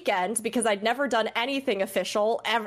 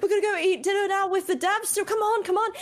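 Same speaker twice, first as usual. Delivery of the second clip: much higher, eager friend voice; imitating Jebro; 10 semitones higher than usual. To Jeb, mimicking his excited invitation at the event, high and eager. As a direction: bounce it up high like an excited buddy. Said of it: "this is exactly how you sound".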